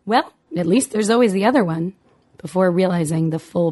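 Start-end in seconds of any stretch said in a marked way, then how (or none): 0.0-1.9 cheerful. A narrator's reading. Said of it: "Bertie had said cheerfully".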